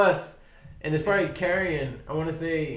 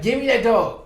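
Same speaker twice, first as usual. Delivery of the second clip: eager grab; 6 semitones higher, 6 dB louder than usual. Retold or imitated. Mother grabbing for the puppy.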